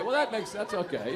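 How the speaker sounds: ordinary talk, nothing marked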